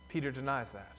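ordinary delivery

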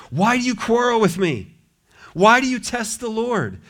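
high, pleading rebuke; exasperated Moses. Acted. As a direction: rebuke the crowd in despair.

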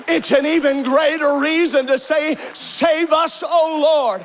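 full-force preaching. Acted. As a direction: preach it out to the whole congregation. Preacher building to full-force climax.

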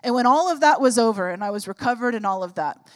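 ordinary talk

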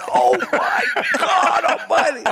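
as if he can't believe his luck; mock amazed outburst with a laugh; stunned disbelief. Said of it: "And he went".

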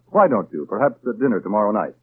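ordinary talk

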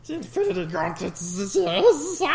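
loud, high grand villain; hammy villain offer. Retold or imitated. Villain line in silly banter.

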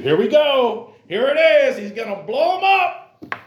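high, loud hyped-up cry; mock alarmed excitement. Preacher to congregation, mimicking an expected blow-up: high excited shouting. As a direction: holler it like a blow-up is coming. Mock anticipation of Jesus exploding.

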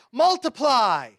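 high, loud, drawn-out mock command; slow, grandiose showman's proclamation; mock magic command. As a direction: boom it out like a stage wonder-worker. Mock miracle command Jesus didn't say.

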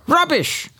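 high scornful "Rubbish"; mock Brexiteer cry. Commentator mimics Brexiteers dismissing forecasts, high scornful cry. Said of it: "the Brexiteers cried".

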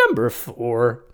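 put-on thin squeaky boyish voice. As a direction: do a silly squeaky kid voice. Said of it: "You sound like a squeaky boy".

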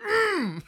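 high, urgent closed-mouth hum; high imitated "mm" with lips shut; mouth-full grunt. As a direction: imitate calling out through a mouthful of food. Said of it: "with a mouthful of food, he, he points and goes".

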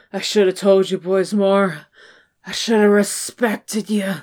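lightly voiced apologetic father; measured, mock-solemn dad confession; remorseful dad. Dad apologizing to his sons, lightly acted remorse. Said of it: "he's like".

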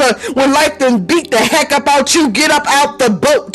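rhythmic preacher's call; building sermon refrain; preaching refrain. Acted. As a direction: drive the refrain home like a sermon.

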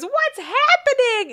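high, loud, drawn-out mock wail; hammed-up horror-movie cry; panicked daughter. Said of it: "The daughter comes back and she goes".

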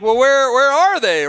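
put-on suddenly realising the kids are gone; mock panic. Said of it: "then they're like".

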